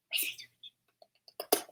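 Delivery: very soft, slow whisper to a pet; whispered coaxing. Real, not acted. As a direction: whisper it slowly to tempt the dog. Coaxing dog, now whispered.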